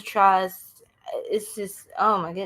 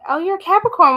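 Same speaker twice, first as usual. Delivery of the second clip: surprised; 9 semitones higher, 7 dB louder, faster than usual. Real, not acted. Spotting a viewer's sign.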